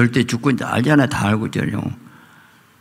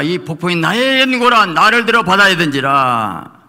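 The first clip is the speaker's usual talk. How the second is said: 외치는 요나; 7 semitones higher, 4 dB louder than usual. Acted. 요나의 고백을 외침.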